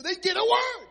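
preaching shout. Real, not acted. Preacher answers congregant, shouting.

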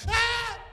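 high startled mock scream; scared yelp. Josh startled by the pastor, high mock yelp. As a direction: yelp like you've just been surprised. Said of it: "and I go, "Hey, Josh".

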